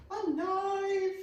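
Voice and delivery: high, lilting echo of the word; playful high repeat; sing-song high echo. Acted. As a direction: chirp it like a little tune. Repeating what she needs.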